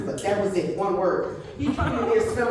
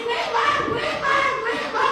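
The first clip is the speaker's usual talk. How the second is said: put-on high-pitched child calling through laughter; laughing kid call; 14 semitones higher than usual. Granddaughter calling her grandma in the store, high laughing call. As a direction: squeal for grandma like a little kid.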